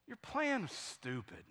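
soft, slow deadpan verdict; retold soft-spoken sixth grader; quiet kid. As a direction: deliver it quietly and slowly, as a kid of few words. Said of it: "He, he's quiet. He didn't speak much".

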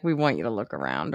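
a hint of low, quiet alien menace; lightly voiced aliens. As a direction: drop low and soft, lightly playing the aliens. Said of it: "maybe the aliens are like".

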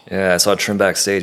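ordinary talk

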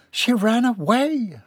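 high, drawn-out cry of dismay; shocked wail. A narrator's reading. Mother reads of daughter's flight.

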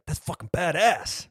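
lightly voiced eighties tough guy; tough guy approval. Cohost quotes the sensei, hammed-up dude approval.

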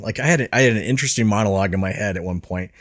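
ordinary delivery

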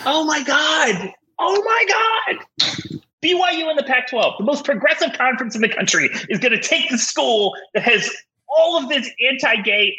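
high exasperated shriek, near tears; screaming crying fan. Real, not acted. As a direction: wail in disbelief at the plan. Fan melting down over league plan.

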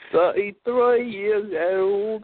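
high, very slow Cockney attempt; Cockney accent. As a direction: stretch out the Cockney phrase. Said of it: "turn your T H to F".

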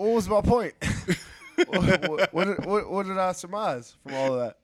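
high, loud laughing question; real laughter while asking. Real, not acted. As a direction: laugh through the words. Laughing at himself.

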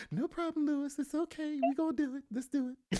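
hammed-up impression of her agreeing; imitating the guest. Host imitating his guest's reply in a high mock voice. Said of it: "You're like".

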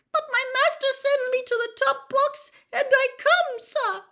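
very high, loud dutiful elf voice; Winky's squeak. A narrator's reading. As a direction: squeak it out with obedient resolve. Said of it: "quivering squeak of a voice".

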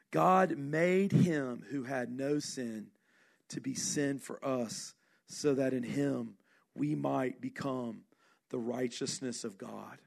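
ordinary talk

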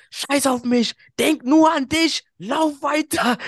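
high, loud, slowed-down mock yell; mock dramatic yell. Imitating the fallen sister yelling at him to run on, hammed-up shout. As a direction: ham up the sister's heroic shout. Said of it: "Dann schreit die mich an".

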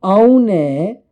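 high, loud punchy catchphrase; Lisa Rinna catchphrase. Retold or imitated. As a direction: belt it like the reality star's line. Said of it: "as Lisa Rinna would say".

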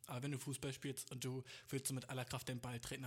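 normal talking voice

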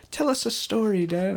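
put-on high pleading child. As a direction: ask sweetly like a little kid. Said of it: "they'd be like".

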